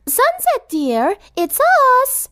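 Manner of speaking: very high, refined call; lady sweetly calling a friend; high, elegant sing-song call; calling through a door. A narrator's reading. Rarity calls through door.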